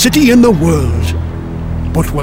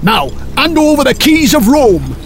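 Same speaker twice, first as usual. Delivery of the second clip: high-pitched threatening Viking order; hammed-up menacing command; fierce demand; 7 semitones higher, 3 dB louder than usual. A narrator's reading. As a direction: demand it like a raider with a sword. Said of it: "held a sword to the throat of the bishop and demanded".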